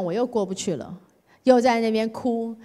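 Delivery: ordinary talk, nothing marked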